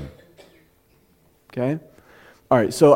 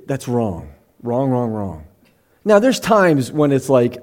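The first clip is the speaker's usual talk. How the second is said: ordinary talk, nothing marked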